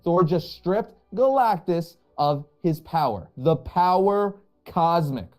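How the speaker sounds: slow, weighty stress on The power cosmic; slow emphasis. Shown as a demonstration. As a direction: lean into The power cosmic word by word. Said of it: "I just need to emphasize that".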